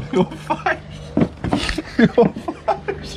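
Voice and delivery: laughing through the words. Real, not acted. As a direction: crack up while teasing. Laughing at friend's face.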